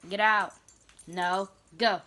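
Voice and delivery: loud sharp commands; shouting at dogs. Real, not acted. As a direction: yell to chase the dogs out.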